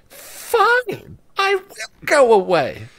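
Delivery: high, squeaky cartoon-friend voice, huffy; a wounded imaginary friend giving in; imaginary friend sulkily agreeing to leave; Bing Bong voice. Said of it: "as Bing Bong".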